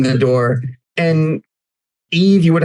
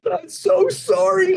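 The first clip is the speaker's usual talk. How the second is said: high, slow, tearful apology; acted weepy voice, pitched way up; weeping guard; slower than usual. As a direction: play a guard blubbering an apology. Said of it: "The nice one is just weeping".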